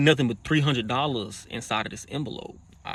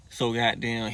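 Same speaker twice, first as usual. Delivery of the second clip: ordinary talk, nothing marked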